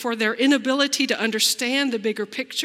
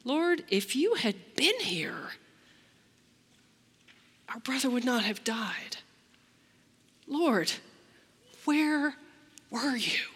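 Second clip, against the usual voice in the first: whispered, hurt reproach; complaint; 4 semitones higher than usual. Shown as a demonstration. Preacher voicing Martha's complaint to Jesus, whispered and hurt. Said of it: "a little bit of complaint".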